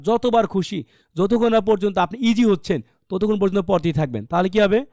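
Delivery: usual speaking voice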